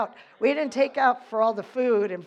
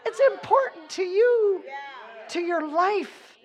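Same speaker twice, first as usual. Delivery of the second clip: high, loud preaching yell; 6 semitones higher, 4 dB louder than usual. Real, not acted. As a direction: shout it at the congregation. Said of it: "here I am yelling at you".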